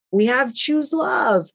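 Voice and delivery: lightly voiced Chinese accent, said carefully; thick Chinese accent. Chinese group tells Tim about their program, mild Chinese accent imitation. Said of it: "in a very thick Chinese accent".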